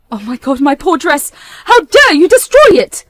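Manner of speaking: from a stunned murmur to a high, loud cry; a mother discovering her own wedding dress shredded; building outrage. Acted. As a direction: rise from shock into outrage. Mother sees her ruined dress.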